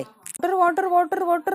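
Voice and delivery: high-pitched repeated plea; drunk pleading. Acted. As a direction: whine the word over and over, high.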